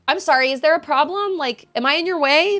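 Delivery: sarcastic comeback. Pretend. Imagined comeback to rude woman.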